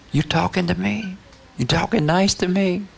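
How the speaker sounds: high, puzzled questioning; acted disbelief. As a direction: ask it as if you can hardly believe it. Astonished sinner, acted.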